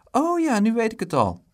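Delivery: high, loud burst of recall; excited high-pitched realisation; sudden remembering. A narrator's reading. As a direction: blurt it as it comes back to you. Dick suddenly remembers his news.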